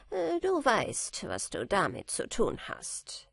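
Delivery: a knowing little man's voice, dropping away; smiling little man. A narrator's reading. Little man sending Michael off with the bottle, smiling. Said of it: "sagte der Kleine und lächelte".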